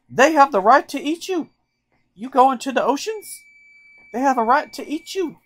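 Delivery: put-on Southern accent. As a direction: lecture like a no-nonsense Southern teacher. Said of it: "she's Southern".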